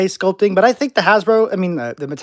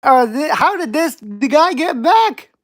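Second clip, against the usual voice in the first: loud, high, drawn-out dismay; exasperated disbelief; 7 semitones higher, 5 dB louder, slower than usual. Real, not acted. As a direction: cry out in disbelief, stretching the words. Banned troll bot reappears in chat.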